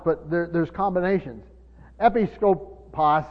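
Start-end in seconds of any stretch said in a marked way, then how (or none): 2.0-3.2 Episkopos said slowly, syllable by syllable, louder; slow word demo. Shown as a demonstration. Pastor teaching the Greek word, slow and deliberate. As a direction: spell out Episkopos for the congregation. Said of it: "is found, and it's translated".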